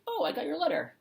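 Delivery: pleased surprise. Pretend. Imagined restaurant worker reply.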